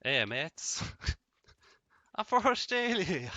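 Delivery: loud, hammed-up mock Australian accent; bad Australian accent. Player shows off impressions of accents, fake Australian. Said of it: "impressions of impressions of accents".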